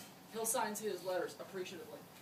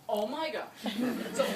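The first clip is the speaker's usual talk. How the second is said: laughing; 6 dB louder, slower than usual. Retold or imitated. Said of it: "that's my David imitation".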